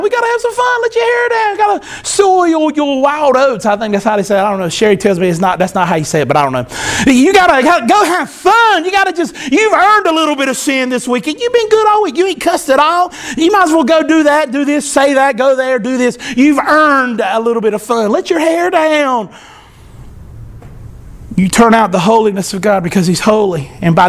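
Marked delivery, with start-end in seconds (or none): 0.0-3.6 high-pitched mock coaxing; voice of temptation. Tempter urging churchgoers to have some fun, high mock coaxing. Voicing those who reject holiness. 7.1-19.3 high, playful mock coaxing; voice of temptation. Tempter telling churchgoers they've earned some sin, high mock coaxing. Resumes voicing the excuse for sin.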